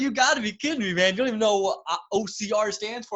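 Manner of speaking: high, loud mock disbelief; imitated flabbergasted racer; incredulous teasing. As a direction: rib the clueless newcomer loudly. Imitating racers teasing his ignorance.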